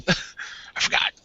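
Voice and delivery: ordinary talk, nothing marked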